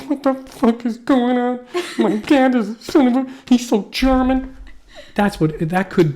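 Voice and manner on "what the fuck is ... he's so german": high, slow hammed-up wail; mock crying. Sensitive son lamenting his German dad, mock crying. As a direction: blubber in fake despair. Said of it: "walking around crying all the time".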